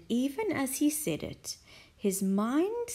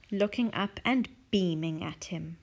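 ordinary delivery, nothing marked

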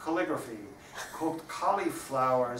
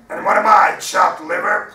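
loud, higher indignant pirate protest; 5 semitones higher, 15 dB louder than usual. Acted. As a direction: throw it back at him, offended and loud. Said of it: "protested the pirate".

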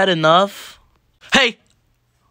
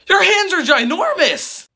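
amazed; 11 semitones higher, slower than usual. Acted. Shocked at huge hands.

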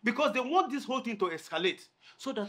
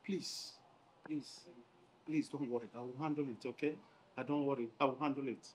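lower, softer pleading calm; soft reassurance; 6 semitones lower, 11 dB quieter than usual. Acted. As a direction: gently talk her down. Chief calming her softly.

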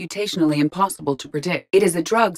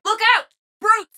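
very high-pitched cry of danger; alarmed warning shout; 3 dB louder than usual. Acted. Warning of brutes.

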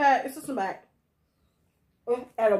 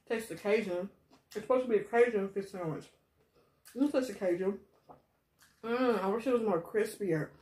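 talking while chewing. Real, not acted. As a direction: mouth full of food. Seen 1: Hand covers mouth chewing bites while talking, sandwich in hand.

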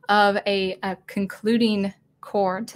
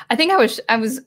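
ordinary talk

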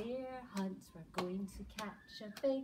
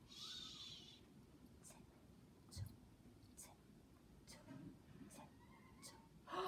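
very soft tense whisper; hushed whisper; 18 dB quieter, much slower than usual. Acted. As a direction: tiptoe in a secretive whisper. Creeping into the gloomy cave.

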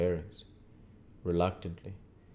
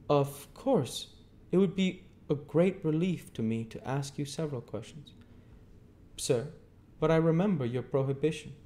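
put-on higher, deferential young man; polite young Pip; 10 semitones higher, 4 dB louder than usual. A narrator's reading. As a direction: answer the guardian carefully, respectfully. Young Pip voiced in reading.

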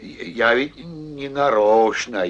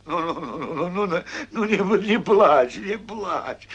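hushing; 7 semitones higher than usual. Acted. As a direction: lull the crying hare. Bear hushes crying hare.

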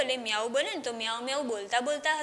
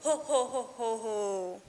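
ho ho chuckle fading slow and soft; sleepy ho ho, trailing off; ho ho laugh; 5 dB quieter, much slower than usual. Shown as a demonstration. Teacher acting the crow's laugh for pupils, slow fading chuckle. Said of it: "કેવી રીતે હસવાનું".

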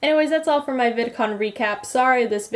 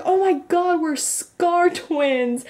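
high, thrilled squeal, stretched out at the end; imitated excitement; 8 semitones higher, slower than usual. Retelling Lee seeing the matching scar, imitated excitement. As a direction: mimic her delighted outburst, drawing out the last words. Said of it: "She was so excited".

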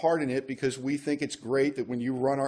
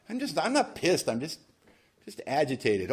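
mock agitated denial; 3 semitones higher than usual. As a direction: play the guy who swears he's not pissed. Acting out an agitated denier.

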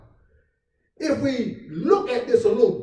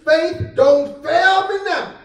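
drawn out slowly and pitched higher; slow preached refrain; 6 semitones higher, slower than usual. Acted. Pastor pleading with the congregation, slow and high. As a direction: stretch each word like a plea.

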